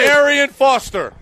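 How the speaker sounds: high, stretched emcee call; calling out a name. Real, not acted. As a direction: announce the player to the crowd. Calling names for crowd applause.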